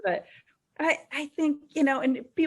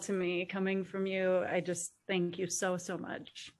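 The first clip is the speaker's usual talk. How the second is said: tearful thanks; 3 semitones lower, 7 dB quieter than usual. Real, not acted. Moved thanks to host.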